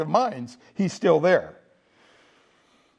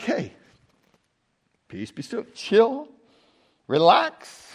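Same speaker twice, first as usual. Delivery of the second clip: calming the disciples; 3 semitones higher, slower than usual. Retold or imitated. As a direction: settle a panicked room gently. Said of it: "So Jesus is like".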